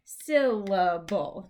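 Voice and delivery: SYLLABLE broken slowly into syl-la-ble; slow, counted-out SYLLABLE, first beat strongest. Shown as a demonstration. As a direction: sound out SYLLABLE slowly, as if clapping each beat. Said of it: "you count out with claps".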